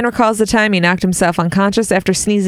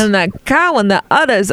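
usual speaking voice

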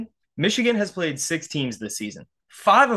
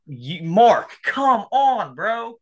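higher-pitched, exasperated protest; pissed off; 6 semitones higher than usual. Real, not acted. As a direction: let him know he's getting to you. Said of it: "just to piss me off".

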